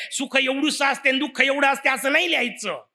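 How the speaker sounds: ordinary delivery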